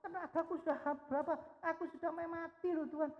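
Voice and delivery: very high, whining, rushed self-pity; whiny complaining prayer. Retold or imitated. A believer listing sacrifices to God in prayer, whining high. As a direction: whine at God like a martyr. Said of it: "wah, banyak ngomongnya".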